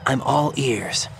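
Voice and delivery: hushed, slow whisper; whispered over the radio. Acted. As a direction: keep it down, breathe the words. Whispered codec reply.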